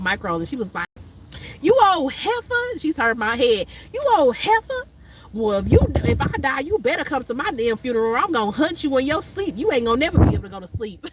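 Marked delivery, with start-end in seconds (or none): 1.6-2.8 put-on high teasing old-lady scold; hammed-up sassy elder calling her out; mock scolding old lady. Late friend teasing her while braiding, high scold. 3.9-11.1 put-on high, teasing threat from an old lady; mock sassy old lady. Friend demanding she come to the funeral, high mock threat.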